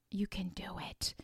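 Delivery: slow, hushed whisper; soft whispered you can do it; whispered encouragement. Real, not acted. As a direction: breathe it out softly as reassurance. Intimate encouragement.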